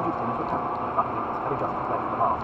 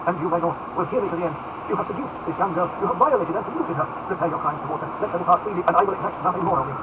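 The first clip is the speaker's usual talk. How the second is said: raised, insistent denunciation; forceful, higher-pitched earnest demand; impassioned accusation; 6 semitones higher, 5 dB louder than usual. Acted. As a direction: confront the Duke and demand redress.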